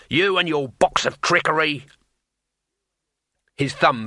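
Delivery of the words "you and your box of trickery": higher-pitched angry accusation; screamed accusation. Acted. Thief grabbing the wizard by the neck, screaming. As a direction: scream it with some restraint. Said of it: "he screamed".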